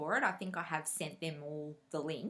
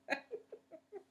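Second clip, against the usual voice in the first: laugh; 14 semitones higher, 6 dB quieter than usual. Real, not acted. Laughing at the memory.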